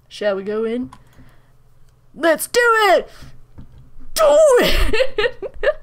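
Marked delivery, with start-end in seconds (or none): 2.1-5.7 higher and louder rally cry; held-back yell. Pretend. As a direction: call it out like a battle cry. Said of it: "I was actually gonna yell that".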